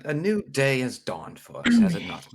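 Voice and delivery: high, loud and theatrical; rousing cheer. Acted. Gnome rallying his new friend after grim talk, loudly upbeat. Gnome breaks the solemn hush.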